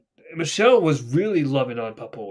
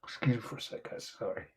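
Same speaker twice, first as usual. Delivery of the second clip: very soft, voiceless apology; upset; 4 semitones lower, 13 dB quieter than usual. Real, not acted. Host excusing himself after getting upset, hushed apology. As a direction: barely voice it, as if overcome. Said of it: "I was gonna get upset".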